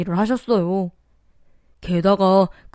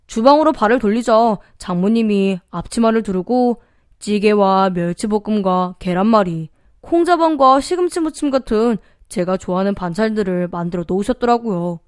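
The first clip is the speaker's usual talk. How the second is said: usual speaking voice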